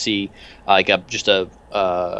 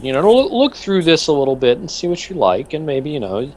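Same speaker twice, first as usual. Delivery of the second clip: higher, kindly doctor reassuring the captain; a lightly voiced doctor, soothing; doctor's voice; 6 semitones higher than usual. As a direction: reassure him like a gentle doctor. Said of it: "And he said".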